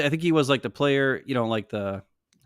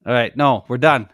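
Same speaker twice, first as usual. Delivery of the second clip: usual speaking voice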